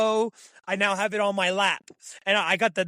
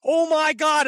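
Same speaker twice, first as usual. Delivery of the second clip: high, loud burst of panic; retold panic in the tree stand; panicked; 7 semitones higher, 4 dB louder than usual. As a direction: blurt it out, freaking out. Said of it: "And I was like".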